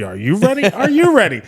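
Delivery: loud call, climbing high on the repeat; crowd hype. Retold or imitated. Band rouses crowd before next song, loud hype. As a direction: imitate a performer firing up the audience.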